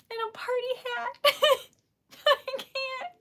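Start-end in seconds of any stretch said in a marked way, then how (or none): 2.3-3.1 tearful. Real, not acted. Overwhelmed, crying.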